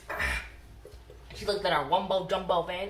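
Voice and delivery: usual speaking voice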